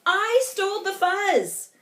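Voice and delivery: high, loud proud boast; Big Bark boasting from above; boastful. Acted. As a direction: gloat over the theft.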